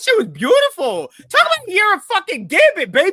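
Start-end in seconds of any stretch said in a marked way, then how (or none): 1.3-3.1 much higher, loud hype cry; hype shout. Real, not acted. Host hyping the year of Gambit, shouting. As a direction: shout it like a victory chant.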